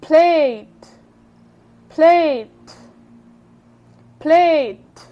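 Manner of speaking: Plate said loud and clear, each take firm; word demo. Shown as a demonstration. Teacher to students, modelling plate loudly. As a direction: model plate firmly for repetition. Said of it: "face".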